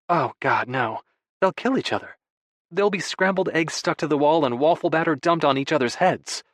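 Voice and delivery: lively, lifted protest; mock-alarmed wife. A narrator's reading. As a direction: play the wife comically dreading the mess. Narrator voices alarmed Freya.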